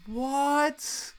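loud, high, slow cry of disbelief; shocked disbelief. Retold or imitated. Coworkers hearing of the firing, loud high disbelief. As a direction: let the shock burst out.

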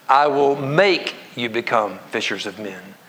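"make" stretched and lifted, slow reading; stress on make. Shown as a demonstration. Preacher rereading the verse aloud with congregation, stress on make. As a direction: lean on the word "make", pause, then finish slowly. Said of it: "reread it and emphasize the different".

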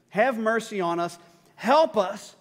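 acted desperate pleading; at his wit's end. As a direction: beg as a desperate father would. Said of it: "he's at his wit's end".

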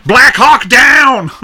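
high, loud drawn-out holler; shouted movie quote. Acted. Cracking a joke on the film, mock shout. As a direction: yell it like a mock battle cry.

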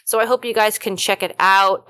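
usual speaking voice

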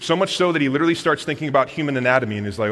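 normal talking voice

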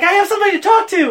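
high, fast, loud burst of joy; excited shout. Real, not acted. A comic fan shouts to his friend, fast and thrilled. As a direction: blurt it out, thrilled to share.

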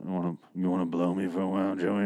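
mock misquote. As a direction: rattle it off as a dirty joke. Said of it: "I'm like".

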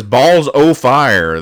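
slow, loud drawn-out exclamation; mock hillbilly outburst; hillbilly sheriff exclaiming. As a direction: stretch it out like a startled country sheriff.

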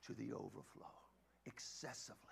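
breathy awed whisper, very soft; hushed whisper. Acted. Preacher whispering the promise to the congregation. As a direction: lean in and whisper the abundance.

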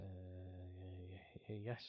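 odd hushed stammering voice; exaggerated cartoon intonation. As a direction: stammer it out in a strange quiet voice. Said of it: "Why are you talking like that?".

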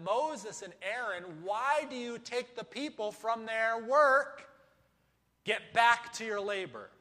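angry Pharaoh. Acted. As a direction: bark the order at the slaves. Pharaoh voiced as a demanding king.

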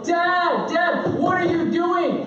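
put-on high, drawn-out child's whine; pestering kid. Kid at the bathroom door pesters dad, high mock child voice. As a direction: imitate a kid demanding attention. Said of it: "And so they go".

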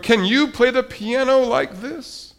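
high-pitched, showing-off question; boastful cry. A narrator's reading. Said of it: "cried Toad".

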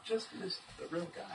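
high, soft, half-whispered oops; mock sheepish leaders. Leaders who just missed Jesus, in a high hushed mock oops.

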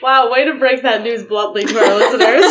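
loud, laughing mock reproach. Real, not acted. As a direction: scold them jokingly through laughter. Joke about death, everyone laughing.

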